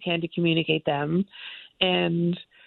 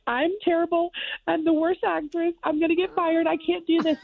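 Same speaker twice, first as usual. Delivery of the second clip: much higher, frantic run of self-doubt; panicked wail; 11 semitones higher than usual. Retold or imitated. Calling her manager from the bathroom, high panicked wail. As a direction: spiral into high-pitched panic.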